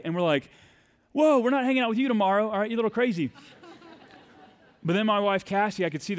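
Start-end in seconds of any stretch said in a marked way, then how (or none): 1.1-3.3 higher, louder, fast mock alarm; startled whoa. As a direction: back away with a quick startled laugh. Friends recoiling from drunk stranger.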